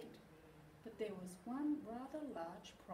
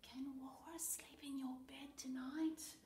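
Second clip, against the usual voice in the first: put-on whispered child's request; hushed, breath-only pleading question; 5 semitones higher, 3 dB quieter than usual. A narrator's reading. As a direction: ask in a secretive whisper. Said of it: "he whispered".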